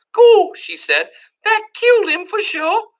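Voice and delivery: put-on high, loud dim girl voice; Meg's voice.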